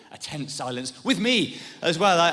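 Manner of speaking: normal talking voice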